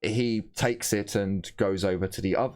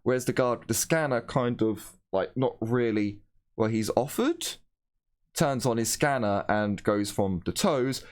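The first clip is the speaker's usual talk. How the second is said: ordinary delivery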